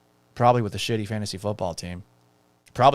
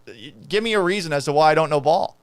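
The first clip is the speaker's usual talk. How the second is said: ordinary talk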